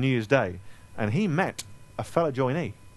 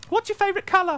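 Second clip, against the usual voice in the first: sudden high, bright squeak of a question; high-pitched comic letdown; mock anticlimax; 3 dB louder than usual. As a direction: undercut the big build-up with a tiny high voice. Mock-grand build-up to trivial question.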